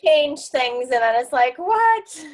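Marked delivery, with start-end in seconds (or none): 1.6-2.3 high, loud mock outrage; exasperated. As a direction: squeal of fake indignation. Mock user annoyed by app change.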